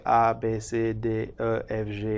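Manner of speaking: A, B, C said "ah, bé, cé" in French; French alphabet. Shown as a demonstration. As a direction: recite A to G with French letter names. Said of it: "if you're studying French".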